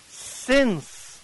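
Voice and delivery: "Since" said alone, pitched high and held; stress on since. Shown as a demonstration. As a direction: hold the lone word "Since" up high, then let it fall. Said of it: "the word since".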